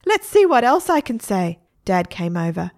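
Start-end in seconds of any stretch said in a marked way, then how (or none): 0.1-1.6 high, loud parrot echo; mimicking parrot. Parrot echoing Mum, high mimicking voice. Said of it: "said the parrot".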